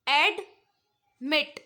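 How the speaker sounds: Admit spoken very slowly, split into its two syllables; slow classroom demo of admit. Shown as a demonstration. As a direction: drag admit out to show both syllables. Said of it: "दो syllable".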